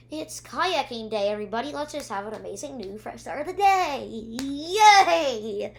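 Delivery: sing-song pep talk ending in a loud yay; mock cheerleading. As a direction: imitate an over-cheery rallying cry. Retold forced cheer amid quarrel.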